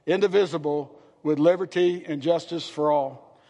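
pledge recitation. Acted.

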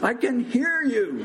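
high-pitched shouted retort; shouting back. A narrator's reading. Hidden patient to shouting doctor, shouting back. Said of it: "Mister Stoltz shouted back".

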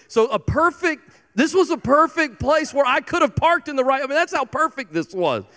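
high-pitched mock gush; exaggerated perfect. Real, not acted. Pastor to congregation, joking about parking, high mock gush. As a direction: gush way up over how perfect it was.

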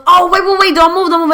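high, loud mock panic; urgent calling out. Date begging the cab to wait, urgent high calls. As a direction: call out in a hurry to stop the car.

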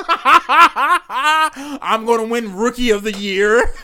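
slow, laughing through the words; can't get it out for laughing. Real, not acted. Man joking about his NBA dream, laughing loudly.

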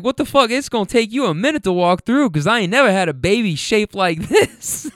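high-pitched retold exasperation, breaking into laughter; tickled, disbelieving rant as the mother; mock incredulous. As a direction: imitate a baffled mom, up high. Retold mama joking about her baby.

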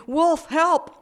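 very high, loud shout; high cry of alarm; crying out for help. A narrator's reading. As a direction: yell for rescue at the top of your voice. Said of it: "she cried again".

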